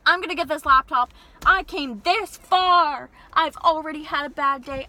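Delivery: loud, frustrated insisting, slowing on the middle line; an upset customer near the end of her rope; upset insistence. Retold or imitated. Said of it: "And I was getting upset".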